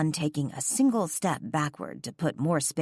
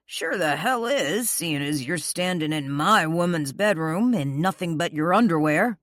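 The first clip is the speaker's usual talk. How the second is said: growling; 2 semitones higher, 3 dB louder than usual. A narrator's reading. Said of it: "Declan growled".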